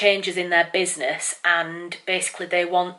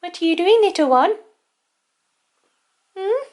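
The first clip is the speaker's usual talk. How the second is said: silly cat voice; 12 semitones higher, 4 dB louder than usual. Real, not acted. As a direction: coo at the cat in a sing-song voice. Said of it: "I'm gonna stop talking in silly cat voice now".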